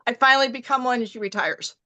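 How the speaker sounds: normal talking voice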